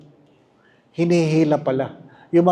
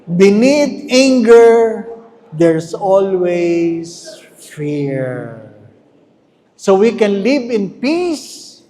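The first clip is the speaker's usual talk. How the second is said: emphatic slow preaching; 3 dB louder, slower than usual. Real, not acted.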